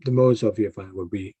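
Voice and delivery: ordinary talk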